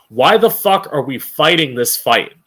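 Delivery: ordinary talk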